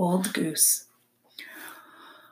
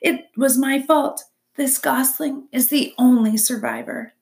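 upbeat, raised pitch despite bad news; incongruously cheerful; 5 semitones higher, 5 dB louder than usual. A narrator's reading. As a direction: confess as if sharing good news. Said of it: "why did your voice sound so cheerful".

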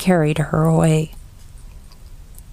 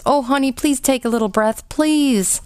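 desperate pleading; 7 semitones higher than usual. A narrator's reading. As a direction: beg her to breathe, voice rising. Boy begs dying sister.

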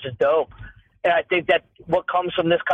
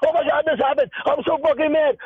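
much higher, hammed-up furious rant; over-the-top fake anger, pitched way up; mock outrage; 10 semitones higher than usual. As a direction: parody an online hothead losing it. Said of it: "everyone shoots for this immediate reaction".

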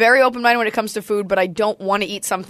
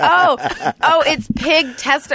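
higher, gushing hipster voice; mock hipster; 5 semitones higher than usual. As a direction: ham up a pretentious foodie. Mocking a hipster foodie.

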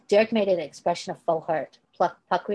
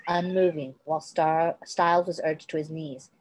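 ordinary delivery

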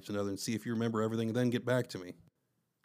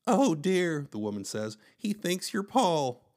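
fretting mother; 7 semitones higher, 5 dB louder, slower than usual. A narrator's reading.

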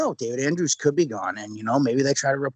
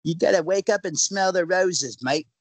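higher, put-on Australian accent; 5 semitones higher than usual. Podcaster replaying his line for co-host, mock Australian accent. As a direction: lay on a mock Aussie accent. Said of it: "sound straight up like a person from New South Wales".

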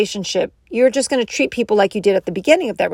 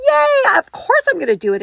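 squealing high with delight, almost dancing; mock over-the-top cheerful partner; gleeful yes; 13 semitones higher, 3 dB louder than usual. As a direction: burst out with happy eagerness. Eager partner happily agreeing to chores.

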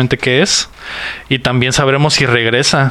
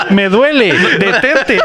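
laughing; 9 semitones higher, much slower than usual. Pretend. Mock trainee dying from exercise.

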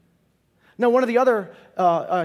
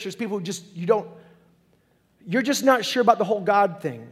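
ordinary delivery

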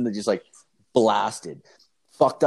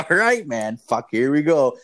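higher, louder mock here-we-go reaction; retold exasperation; 4 semitones higher, 6 dB louder than usual. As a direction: act out bracing for trouble, pitched up. Retelling his reaction to insult.

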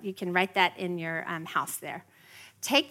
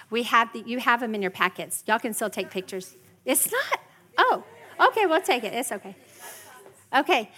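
normal talking voice

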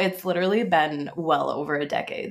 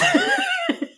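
squealing laugh. Real, not acted.

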